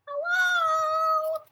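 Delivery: high, loud, drawn-out call. Acted. Child calling to Beagle up in the tree, calling out. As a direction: holler a long hello to someone far off.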